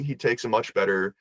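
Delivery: usual speaking voice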